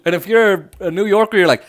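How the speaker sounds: ordinary talk, nothing marked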